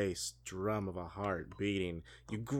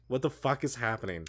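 ordinary talk, nothing marked

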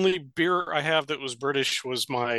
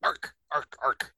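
dog barking; slower than usual. Pretend. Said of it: "Nah, bad dog".

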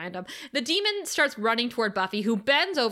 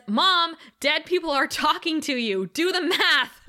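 higher, louder mock exasperation; emphatic, pitched-up scolding; Buffy, exasperated; 6 semitones higher, 4 dB louder than usual. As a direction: spell it out to an obtuse parent. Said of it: "And Buffy says".

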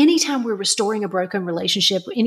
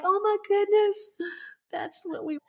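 much higher, choked up, fading very soft; tearful awe; 12 semitones higher, 6 dB quieter than usual. Retold or imitated. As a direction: voice breaking with moved tears, trailing away. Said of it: "I had tears when Danielle said that".

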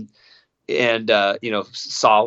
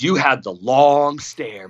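slow retold line; 3 dB louder, much slower than usual. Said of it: "he goes".

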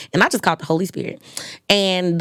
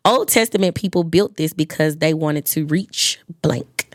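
ordinary delivery

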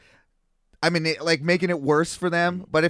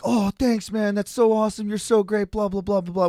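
imitating the gushing drunk; 6 semitones higher than usual. Drunk stranger gushing thanks.

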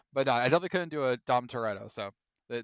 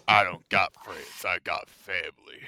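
deep gruff Dom voice; 7 semitones lower than usual. Retold or imitated. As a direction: growl it out like Dom Toretto. Deep Dom Toretto impression.

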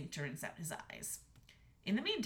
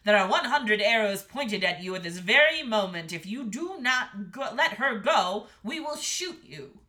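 loud, threatening kingly command; bellowing king; 12 dB louder than usual. A narrator's reading. King threatening the dragon with archers, bellowing. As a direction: threaten at full voice, firmly. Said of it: "bellowed the king".

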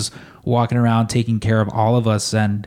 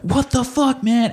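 much higher, a hammed-up outraged shout; mock angry yell; 13 semitones higher, slower than usual. Rude customer yelling after his order is thrown out. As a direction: squeal it like a wronged customer.

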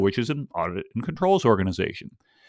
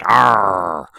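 low, loud pirate "arr"; pirate growl; 4 semitones lower, 6 dB louder than usual. Shown as a demonstration. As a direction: growl it like an old sea dog. Pirate exclamation after announcing pirate talk.

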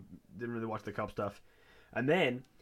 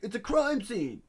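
high, loud mock scolding; fed-up beat cop; 10 semitones higher, 5 dB louder, slower than usual. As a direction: yell it like an exasperated cop on guard. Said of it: "not happy with his job".

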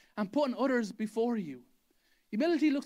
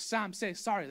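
usual speaking voice

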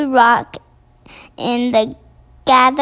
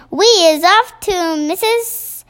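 higher, drawn-out mock yell; quoted giants' yell; 5 semitones higher than usual. BFG mimics the giants going past, mock yell. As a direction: call it out slowly, pitched up, without real force. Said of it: "they was yelling".

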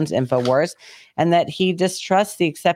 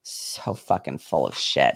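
low, slow, muttered under the breath; disgusted mutter; 6 dB quieter, slower than usual. Real, not acted. Host muttering disgust at the news story, low and slow.

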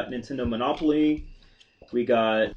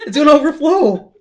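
louder, higher alarmed laugh; excited; 11 semitones higher, 10 dB louder than usual. Real, not acted. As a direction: panic at the overflowing cup. Cup about to overflow.

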